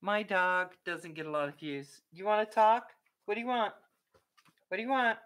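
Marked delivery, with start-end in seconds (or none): 2.1-5.2 high, loud pet-talk voice; raised, higher voice asking a pet; coaxing a dog. Real, not acted. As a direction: prompt the dog to answer, pitched up.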